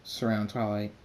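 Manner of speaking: ordinary delivery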